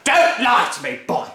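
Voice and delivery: bully the whole family; furious Grandma. Acted. Grandma demanding her breakfast, loud bullying bellow. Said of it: "the enormous old hag shouted".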